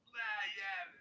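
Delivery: loud, high mock gangsta voice. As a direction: ham up a fake tough guy. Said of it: "wanna sound freaking gangsta".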